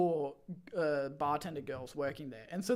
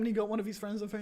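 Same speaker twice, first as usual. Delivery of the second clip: ordinary talk, nothing marked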